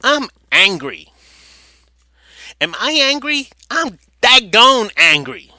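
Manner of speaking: mock angry quote. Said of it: "She's quoted in the article".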